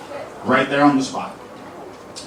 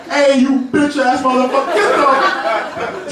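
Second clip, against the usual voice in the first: yelling; 10 semitones higher, slower than usual. Retold or imitated. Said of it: "and I'm like".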